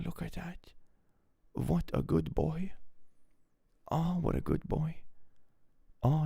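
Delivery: tender high-pitched pet voice. Real, not acted. Cooing at the sleeping cat.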